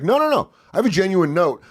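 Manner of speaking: high-pitched acted objection; mock earnest protest. Acted critic protesting being called hater.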